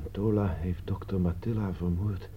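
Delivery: breathless whisper, slowing on the knife; hushed horror, fading to a whisper; shocked whisper. Acted. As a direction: barely get the words out, stunned. Announcing the murder in shock.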